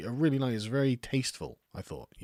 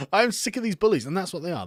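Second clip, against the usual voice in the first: loud, much higher indignant cry; angry outburst; 8 semitones higher, 6 dB louder than usual. Real, not acted. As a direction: let the anger out at bullies. Ranting against press bullies.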